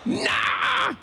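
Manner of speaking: high shouted nah; shouted disbelief. Retold or imitated. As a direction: yell it in wild disbelief.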